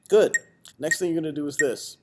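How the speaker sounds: normal talking voice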